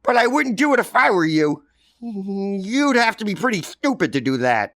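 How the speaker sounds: put-on a high, loud, knowing kid voice; sly kid. Johnny slyly dares Billy to go up, high and knowing. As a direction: dare him with a wink.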